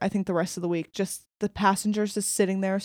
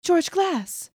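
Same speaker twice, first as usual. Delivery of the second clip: mock teen girl, much higher; 9 semitones higher, much slower than usual. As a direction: play the fibbing teen sister. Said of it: "Where she goes".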